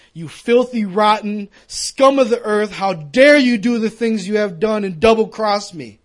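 loud, high contemptuous scolding; shouted condemnation he never spoke; scornful rebuke. Retold or imitated. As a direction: spit it out like a harsh judge. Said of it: "He didn't say".

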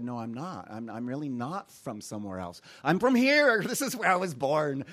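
usual speaking voice

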